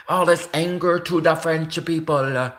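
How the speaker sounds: attempted French accent that slips into Italian; a mock French accent that ends up Italian; failed French accent. Shown as a demonstration. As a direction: try a French accent, miss. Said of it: "Oh, that's Italian".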